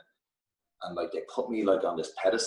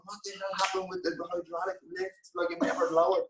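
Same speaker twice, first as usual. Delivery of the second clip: mock angry rant; 10 semitones higher than usual. Retold backstage rant, kicking things.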